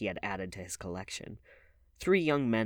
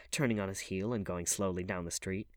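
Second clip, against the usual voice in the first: ordinary delivery, nothing marked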